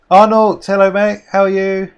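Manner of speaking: high, loud hello to a friend; excited greeting. Real, not acted. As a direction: greet him like a long-lost mate.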